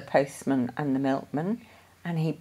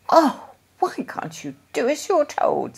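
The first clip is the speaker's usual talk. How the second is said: exasperated; 10 semitones higher than usual. A narrator's reading. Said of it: "said Missus Brown".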